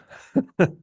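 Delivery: brief, high amused chuckle; quick self-amused laugh; short laugh. Real, not acted. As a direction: laugh briefly at your own impulse. Amused at own impulse purchase.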